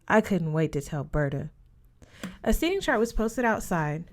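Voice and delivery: ordinary delivery, nothing marked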